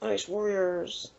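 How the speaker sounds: Ice Warriors drawn out slow and soft, almost breathy; a hushed, spooky Ice Warriors; slow, soft mock-ominous. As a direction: let the name Ice Warriors hang, slow and quiet. Savouring the monster's name.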